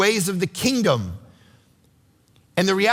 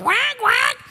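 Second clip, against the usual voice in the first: much higher, squeaky mock dolphin call; dolphin squeak. Mimicking SeaWorld dolphins for the congregation, high squeaky call.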